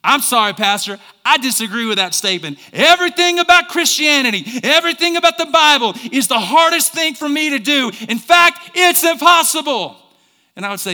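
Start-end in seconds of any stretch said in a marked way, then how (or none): 0.0-8.7 loud, high mock objection; acted protest, climbing higher as it goes; protesting congregant. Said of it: "some of you are going". 8.8-10.0 high, loud acted exclamation; mock protest at full volume; protesting congregant. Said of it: "some of you are going".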